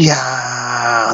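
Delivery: long-held "yaaa" of effort; straining cry. Retold or imitated. Father squeezing out the last toothpaste, mock effort cry. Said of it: "嘴里还发出".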